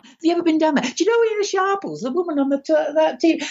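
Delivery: imitated old-mate patter from Manchester. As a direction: launch into eager, high-pitched pub chat as an imitation. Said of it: "he starts in".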